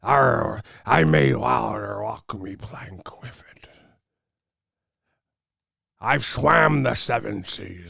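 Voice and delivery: slow, raspy whispered pirate growl; mock him as a gravelly old sea dog; hammed-up pirate impression, drawn out. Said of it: "this motherfucker sound like a pirate".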